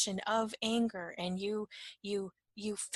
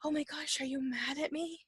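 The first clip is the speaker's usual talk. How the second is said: higher-pitched imitation of a concerned friend; worried companion; 6 semitones higher than usual. Companion checks whether you are mad, higher worried mimicry. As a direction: ask it high, acting out a worried companion. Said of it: "whoever you're with is like".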